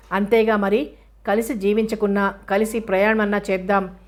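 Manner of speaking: ordinary delivery, nothing marked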